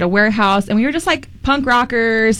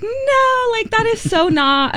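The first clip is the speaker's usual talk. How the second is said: mock dismissive no; 13 semitones higher, slower than usual. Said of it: "And I'm like".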